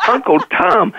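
high imitated call of a name across a room; playful mock yell of a nickname across a buffet; retold calling out. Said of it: "She's screaming across the room".

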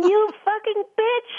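mock cursing outburst. Teresa cursing the Mother Superior, a high mock outburst. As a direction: fling the curse like a retold explosion. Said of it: "Teresa just explodes, and she starts cursing her".

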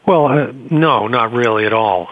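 normal talking voice

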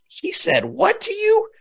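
high, drawn-out mock disbelief; parent's outrage. Imagined parent reacting to the story, mock outrage. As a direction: react as an incredulous parent, voice shooting up. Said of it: "like any mom, like any parent".

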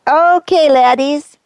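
a Scottish-sounding attempt at an American accent; fake accent. Saint trying to sound American, slipping into a wrong accent. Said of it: "sound like an American".

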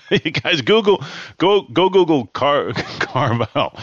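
giggling through the words; laughing at himself. Real, not acted. Host amused by his own ad imitation, laughing as he speaks. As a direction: break up mid-word, then recover. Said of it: "I'm laughing at myself".

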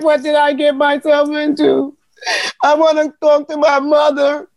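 retold tearful plea, a touch of crying; half-crying plea to go home. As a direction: whimper it like a scared young recruit. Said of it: "I was already crying".

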